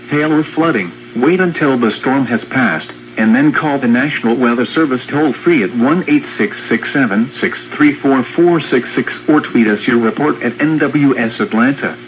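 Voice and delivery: ordinary talk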